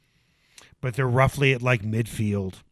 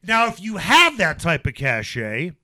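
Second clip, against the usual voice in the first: higher, louder, fired-up rant; heated rant; 7 semitones higher, 4 dB louder than usual. Real, not acted. Host rants to co-host about Lock, loud and heated. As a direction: let the anger build through the rant. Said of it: "this is where I go on my rant".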